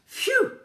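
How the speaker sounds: very high loud phew; big sigh of relief; relieved exclamation; dog's relieved phew. Acted. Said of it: "said the dog".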